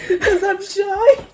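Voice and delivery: high, drawn-out squeal through laughter; mock his bashfulness, stretched and high; mock shy guy. Mocking him claiming to be shy.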